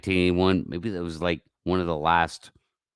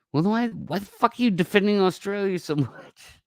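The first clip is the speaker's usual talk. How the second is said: exasperated outburst. Real, not acted. Host snaps at an open mic caller, high exasperated outburst. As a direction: fire it back at the annoying voice on the line.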